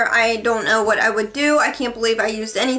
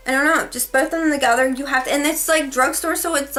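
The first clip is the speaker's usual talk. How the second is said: ordinary delivery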